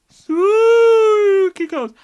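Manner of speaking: high, very drawn-out wail; spooky ghost voice. Pretend. Reluctant ghost mocking the spooky voice, wailing. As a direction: moan it like a ghost. Mock ghost voice mid-line.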